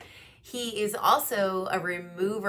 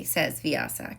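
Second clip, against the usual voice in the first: plain reading voice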